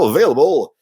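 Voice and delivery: normal talking voice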